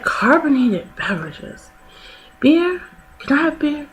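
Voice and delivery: high, hushed little voice asking about beer; mock timid question. Mom in the crowd asks the doctor about beer, high and hushed. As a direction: squeak it out hopefully, barely above a breath. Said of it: "and she was like".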